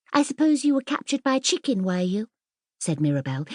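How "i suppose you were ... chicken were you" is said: quick high mocking jab; teasing. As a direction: tease her, quick and high. Mirabel teasing Ellen.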